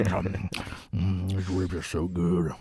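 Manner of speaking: low, slow, moaning over a rib; mock foodie moan over the food. Shown as a demonstration. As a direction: savor every word, way down low. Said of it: "That's my food voice".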